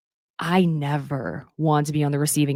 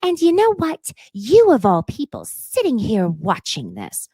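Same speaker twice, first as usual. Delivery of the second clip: accusing Kyle; 5 semitones higher, 5 dB louder than usual. Retold or imitated. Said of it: "she turns on Kyle".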